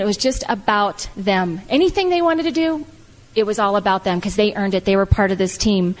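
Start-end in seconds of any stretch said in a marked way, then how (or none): none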